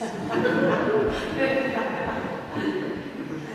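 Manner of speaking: a breathy, airy little laugh. Real, not acted. Reader laughs at own sandwich memory.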